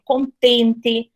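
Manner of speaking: contente with a crisp final "te", no "tchi"; Curitiba pronunciation. Shown as a demonstration. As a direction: say contente ending in a clear "te". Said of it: "Nós falamos".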